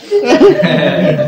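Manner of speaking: a sudden laugh, high and loud. Real, not acted. The master laughs about doctors who talk to ghosts, high and loud.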